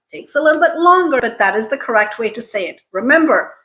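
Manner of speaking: normal talking voice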